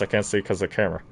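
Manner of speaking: ordinary delivery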